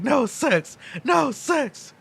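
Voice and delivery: high pleading little voice; high squeaky protest. Retold or imitated. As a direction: squeak it out weakly.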